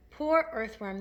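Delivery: ordinary delivery, nothing marked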